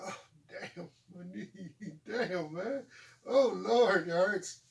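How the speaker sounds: udawane jęczenie z bólu, które narasta od cichego do głośnego; mock whining. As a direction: whine about the knee, from a murmur to a loud moan. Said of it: "I whine, like".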